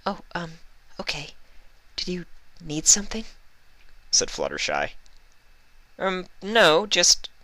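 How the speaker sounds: shy, halting; small high pony voice, unsure; timid pony voices. Acted. Fluttershy and Twilight voiced.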